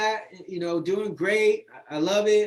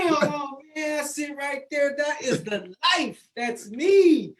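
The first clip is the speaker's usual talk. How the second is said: excited; 5 semitones higher, slower than usual. Real, not acted. Excited at his own photo.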